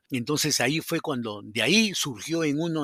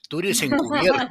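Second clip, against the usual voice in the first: laughing through a higher, louder line; 7 semitones higher, 5 dB louder than usual. Retold or imitated. Director teasing he's undercover, laughing. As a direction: break into a laugh on the joke.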